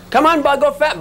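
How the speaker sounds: playful high-pitched urging; urging the bug. Acted. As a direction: egg the bug on, high and insistent.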